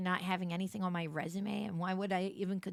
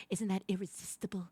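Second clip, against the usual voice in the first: whispering; 3 semitones lower, 5 dB quieter than usual. Acted. Whispered line in pitch.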